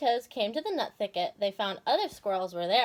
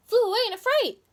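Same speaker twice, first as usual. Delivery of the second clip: much higher and louder, startled; frightened flutter; 11 semitones higher, 6 dB louder, faster than usual. A narrator's reading. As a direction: squeak it out like a startled bird. Bird flees in fright.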